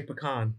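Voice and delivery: "pecan" ending in KAHN; puh-KAHN pronunciation. Shown as a demonstration. Telling the loser what to say, "pecan" as puh-KAHN. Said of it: "You gotta say pecan".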